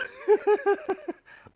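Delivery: squealing high-pitched laugh. Real, not acted. Laughing at his own Aussie joke. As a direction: burst into a shrill giggle.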